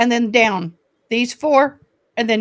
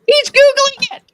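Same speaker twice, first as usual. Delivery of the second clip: high amused squeal; 5 dB louder than usual. Real, not acted. Amused remark about listener.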